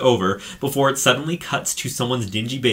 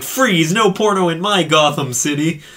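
hammed-up freeze shout, higher and louder; mock hero shout; 6 semitones higher, 5 dB louder than usual. Superheroine bursts in on the couple, mock hero shout. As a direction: bark it like a cheesy caped hero. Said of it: "It's like".

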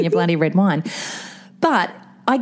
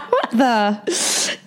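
ordinary talk, nothing marked

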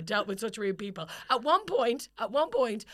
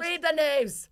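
high, drawn-out mock-angry retort; exasperated retort; 6 semitones higher, slower than usual. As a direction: hammer it slowly at the imagined heckler. Acted retort to heckler, repeated.

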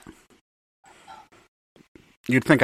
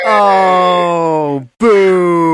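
higher, louder playful booing; mock boo; 6 semitones higher, 7 dB louder than usual. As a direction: boo it like a jeering crowd. Booing the non-local backup beer.

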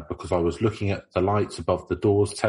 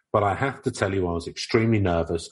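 ordinary talk

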